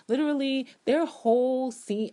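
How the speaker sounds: high, loud exasperated stress; emphatic exasperation. Real, not acted. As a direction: push it in frustration. Emphatic complaint.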